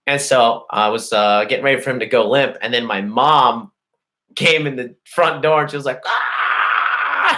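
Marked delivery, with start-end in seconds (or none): 6.0-7.4 high-pitched mock scream; imitated scream. Mom walking in on the fight, imitated freak-out scream. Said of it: "screaming and freaking out".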